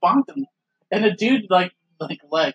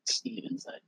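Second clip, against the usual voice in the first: whisper; 16 dB quieter than usual. Real, not acted. Whispered joke to co-host.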